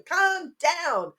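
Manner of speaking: put-on high-pitched small child reciting the answer; little boy. Little son answering parent's step one question, high and childlike. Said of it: "when he was little".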